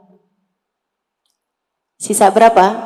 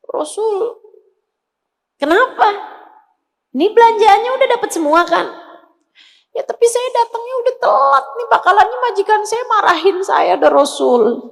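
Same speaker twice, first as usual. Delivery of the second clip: put-on high, fast, anxious child's voice; worried little girl; 7 semitones higher, faster than usual. Slave girl fearing her master's scolding, high and anxious. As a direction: fret like a scared little girl.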